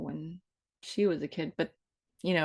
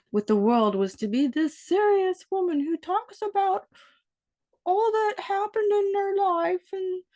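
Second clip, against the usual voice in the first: high, slow, tearful mock gravitas; hammed-up weepy earnest voice; mock serious woman; 14 semitones higher, 4 dB louder, slower than usual. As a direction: play the tragic serious woman, half crying. Mocking a serious tragic woman.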